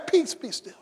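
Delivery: soft, hushed word of peace; calm command. Acted. Jesus speaking to the waves, soft and commanding. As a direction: still the sea with quiet authority.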